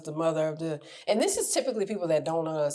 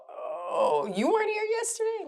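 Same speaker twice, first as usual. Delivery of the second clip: mock surprise. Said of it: "they go".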